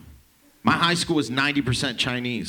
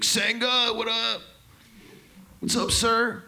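much higher, cheery greeting; friendly call out; 10 semitones higher, slower than usual. Retold or imitated. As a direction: call across the lunchroom. Kids calling out to teacher.

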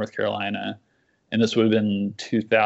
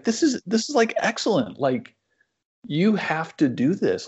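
much higher, eager insistence; Mark, excited; 7 semitones higher than usual. Retold or imitated. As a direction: push him like an excited mentor. Quoting Mark's praise.

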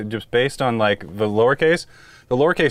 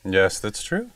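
usual speaking voice

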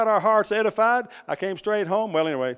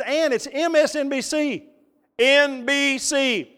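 higher, slow spelled-out letters; drawn-out, louder insistence on each letter; emphatic protest; 8 semitones higher, 4 dB louder, slower than usual. Retold or imitated. Protesting to wife over the channel.

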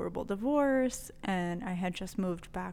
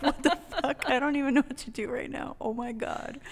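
shocked; 5 semitones higher, 3 dB louder than usual. Retold or imitated.

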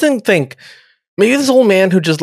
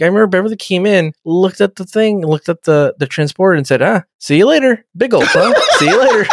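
fast retelling; 3 semitones higher than usual. Retold or imitated. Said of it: "He's like".

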